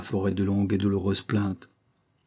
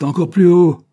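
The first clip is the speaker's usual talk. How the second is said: loud, high, forceful command; shouted order; 9 semitones higher, 12 dB louder than usual. A narrator's reading. Banquiste demands she climb higher, shouted order. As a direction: yell for more height. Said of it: "fit le banquiste".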